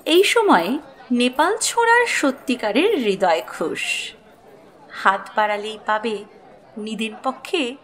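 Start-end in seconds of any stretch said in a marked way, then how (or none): none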